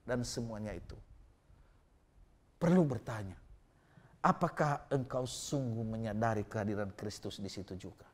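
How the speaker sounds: ordinary delivery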